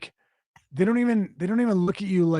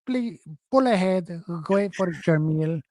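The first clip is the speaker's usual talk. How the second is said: lightly voiced worker, flat order; imitating a curt worker's order; curt drive-thru worker. Correcting his own impression of the worker, lightly accented. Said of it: "They don't even say it like that".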